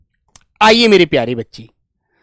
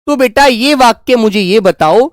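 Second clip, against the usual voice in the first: ordinary talk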